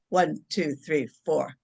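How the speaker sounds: low, even count with spaces between numbers; measured counting. Shown as a demonstration. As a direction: count them calmly through the breath. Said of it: "and I'll go".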